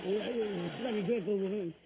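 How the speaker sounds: usual speaking voice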